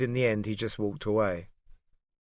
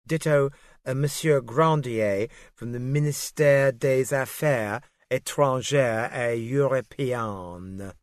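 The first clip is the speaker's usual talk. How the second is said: put-on Ministère des Affaires Étrangères et Européennes in a crisp, proud French accent, slowed down; a secretary's light, show-off French accent on the ministry name; 2 semitones higher, slower than usual. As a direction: savour the French names like a native, showing off. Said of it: "show off her French accent".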